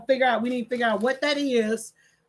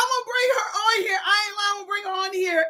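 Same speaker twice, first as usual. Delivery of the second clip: high voice squeezed by real laughter; helpless amusement; 8 semitones higher, faster than usual. Real, not acted. Grandmother promising viewers to bring granddaughter on, laughing. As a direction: talk while getting over a laughing fit. Still cracking up over granddaughter.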